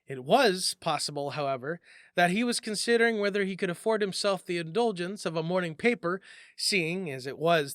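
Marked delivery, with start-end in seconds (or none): none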